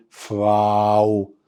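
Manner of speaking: فراو drawn out slowly as fraw; slow model pronunciation. Shown as a demonstration. Teacher telling the kids what to call the lady teacher, slow. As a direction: stretch فراو for the class. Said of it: "هنقول عليها إيه".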